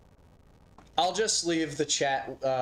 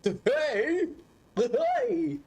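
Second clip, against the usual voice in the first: high cartoon shout. Mimicking game character shouting.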